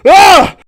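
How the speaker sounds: loud high "Ah!" of fright; startled yell. Acted. Loud high startled yell. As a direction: Harvick yelling as water floods out. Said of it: "Harvick's like".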